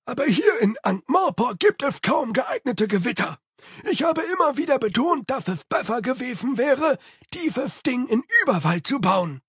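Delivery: high servant voice, grumbling about storms; eager Igor, complaining. Acted. Narrator holds Igor's voice. (hohe, nörgelnde Igor-Stimme)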